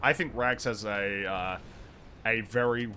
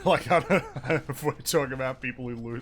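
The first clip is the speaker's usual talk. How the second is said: loud laugh breaking through the words; cracking up mid-sentence; laughing burst; 3 semitones higher, 7 dB louder than usual. Real, not acted. Teasing friends about lost wars, laughing loudly.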